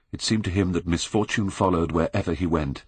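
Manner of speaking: ordinary delivery, nothing marked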